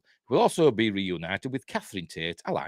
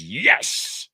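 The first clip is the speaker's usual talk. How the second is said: emphatic yes; 4 dB louder than usual. Acted. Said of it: "Carolyn Bianchi says".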